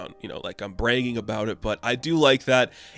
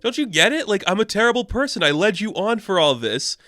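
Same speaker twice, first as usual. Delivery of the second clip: mock lashing out; 9 semitones higher than usual. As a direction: play the scorned woman ranting up high. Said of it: "Ice has her lashing out moment".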